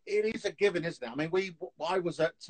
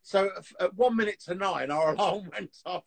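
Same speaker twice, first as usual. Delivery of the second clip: ordinary talk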